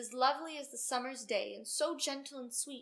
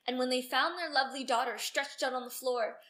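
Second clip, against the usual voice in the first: ordinary delivery, nothing marked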